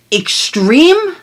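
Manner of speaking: ordinary talk, nothing marked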